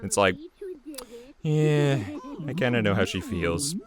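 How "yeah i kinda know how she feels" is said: normal talking voice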